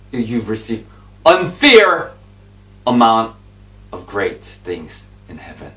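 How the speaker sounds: ordinary talk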